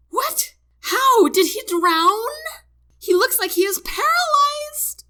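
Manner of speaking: very high, loud, hysterical shriek. A narrator's reading. Wife learns her husband had a stroke, shrieking. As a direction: shriek in panic, pitch leaping up. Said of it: "The woman shrieks again".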